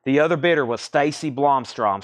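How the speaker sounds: ordinary delivery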